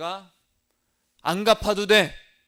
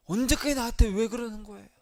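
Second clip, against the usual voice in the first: higher-pitched, exasperated complaint, acted out; mock angry outburst; 4 semitones higher than usual. Believer snapping at the person hurting him, mildly acted anger. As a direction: protest as if fed up, without shouting. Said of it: "막 화를 냅니다".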